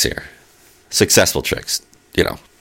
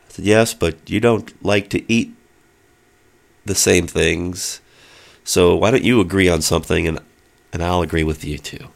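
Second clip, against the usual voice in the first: normal talking voice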